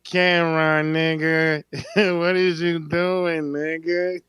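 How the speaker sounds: slow, lightly voiced [name] scolding [name]; imitating [name]. Host imagines [name] teasing [name] in interview, slow mock voice. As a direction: drawl it out, lightly mocking.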